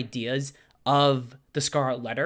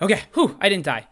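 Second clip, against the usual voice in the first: loud relieved exhale; big relief after a scare; 5 semitones higher, 7 dB louder than usual. Real, not acted.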